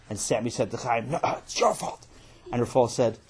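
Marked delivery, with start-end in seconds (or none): 1.1-2.1 voiceless comeback in a whisper; whispered retort. Acted. Brother answering the accusation behind father's back, whispered retort. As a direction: whisper the blame right back.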